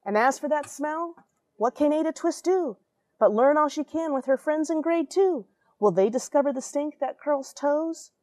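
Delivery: lively read-aloud storytelling; expressive storybook voice; story narration. A narrator's reading. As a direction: read it like a bedtime picture book. Rhyming picture book read aloud.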